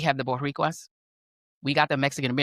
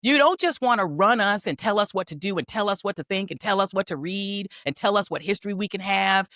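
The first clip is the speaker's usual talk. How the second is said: normal talking voice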